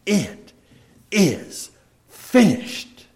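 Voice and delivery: high, loud and slow, ringing in triumph; the cry from the cross, loud and triumphant. Shown as a demonstration. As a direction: proclaim it as a victory, not a defeat. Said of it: "it was said in a loud voice".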